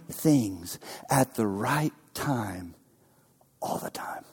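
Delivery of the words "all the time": hushed whispered close. Acted. Preacher assuring the church, whispered. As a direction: let it fall to a whisper.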